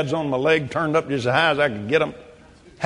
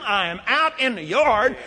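normal talking voice

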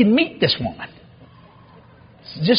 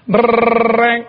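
imitated phone ring; 2 semitones higher, 3 dB louder than usual. Telling how Dad phoned on Christmas Eve, imitated ringing. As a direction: mimic an old telephone bell.

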